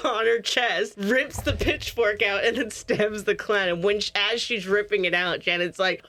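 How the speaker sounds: ordinary talk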